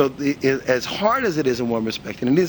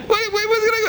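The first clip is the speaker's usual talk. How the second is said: exasperated. Real, not acted. Exasperated rhetorical outburst.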